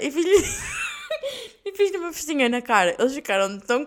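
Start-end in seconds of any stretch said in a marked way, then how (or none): 0.4-1.6 high, quiet squeal of laughter. Real, not acted. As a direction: let out a thin, high laugh. Laughing at her own story. (riso agudo e baixinho)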